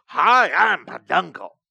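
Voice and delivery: cheery cartoon mascot shout, slow; bright squeaky sing-song welcome. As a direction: greet the kids like a theme-park mascot. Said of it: "some children's mascot at Disney".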